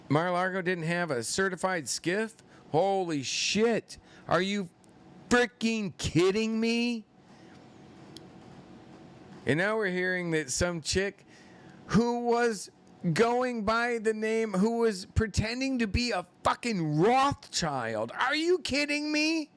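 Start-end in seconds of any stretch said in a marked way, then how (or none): 2.7-7.0 high, drawn-out disbelief; incredulous outburst. Real, not acted. 11.9-19.5 high-pitched, exasperated disbelief; incredulous rant. Real, not acted. Ranting in disbelief at impostor.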